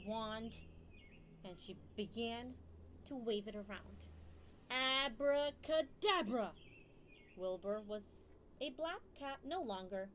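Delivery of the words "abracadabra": a slow, booming magic word; loud spell. Acted. Teacher reading to kids, calling out the spell loudly. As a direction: cast the spell with a grand flourish.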